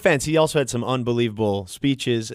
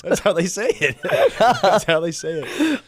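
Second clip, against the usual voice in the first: laughing; 4 semitones higher, slower than usual. Real, not acted. Laughs while explaining pronunciation.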